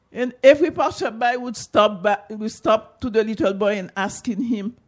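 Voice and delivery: ordinary delivery, nothing marked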